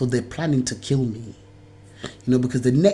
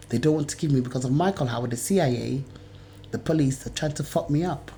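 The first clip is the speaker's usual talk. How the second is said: normal talking voice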